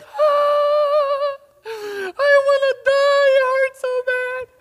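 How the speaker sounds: high, crying wail of pain; crying daughter. Retold or imitated. Daughter sobbing to dad after her bike crash. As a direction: wail it like a hurt little girl. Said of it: "she's like".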